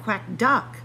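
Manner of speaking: ordinary delivery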